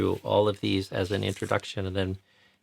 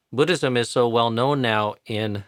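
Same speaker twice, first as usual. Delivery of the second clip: ordinary delivery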